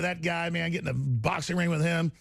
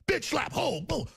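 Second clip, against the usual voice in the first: mock rapper; 8 semitones higher, slower than usual. Imitating rapper lyrics.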